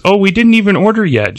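high-pitched acted surprise; surprised. Dazzler to waitress putting down drinks, surprised. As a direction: pitch up, mildly taken aback.

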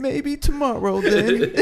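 drawn out and high, brushing him off; slow high dismissive voice. Pretend. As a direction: let him down slowly, high and airy. Voicing wife rejecting.

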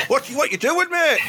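high-pitched incredulous squawk; mock-startled high voice; startled neighbour. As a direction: squeak it out in comic disbelief. Voices someone stuck with Santa in chimney.

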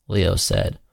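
ordinary delivery, nothing marked

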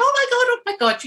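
high-pitched, quick flustered gasping; mock astonished onlookers. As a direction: imitate a startled group all exclaiming at once. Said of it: "everybody's going".

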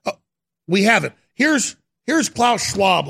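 ordinary talk

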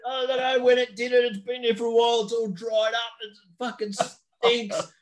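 hammed-up high dad voice griping to his son; imitating dad. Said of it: "And he goes to Charles".

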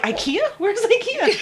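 high, laughing, ditzy mock voice; clueless high voice. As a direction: play it silly and clueless. Mocking her own cluelessness.

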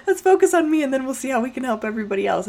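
high, loud voice bubbling with laughter; high-pitched amused self-mockery; laughing concession. Laughing self-quote after friend's advice.

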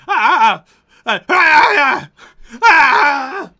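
high, very loud panicked shrieks; freaked-out screaming. Acted. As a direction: shriek like a frightened lizard creature. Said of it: "he gets kind of freaked out".

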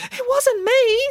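put-on high child's voice; little boy protesting innocence; high-pitched childish denial; small boy denying. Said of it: "said the boy".

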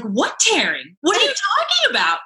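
high, stunned "what are you talking about"; mock horrified question; retold shock. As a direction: shoot the pitch up in disbelief. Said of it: "And I was like".

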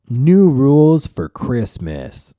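slow, loud announcement of a silly rule; mock solemn reading. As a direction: read it out like a grand decree. Reading out an absurd tweet.

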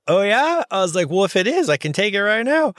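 high-pitched, retold eager reply; acted-out keen interest.

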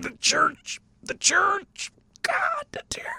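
high, soft mock lament; mock wail. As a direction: wail it like a mournful joke. Mock lament about email.

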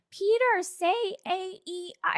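high, sing-song teacher coaxing; high teacher voice coaching the dolphin Peter. Retold or imitated. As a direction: coax like a patient speech teacher. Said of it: "when she's like".

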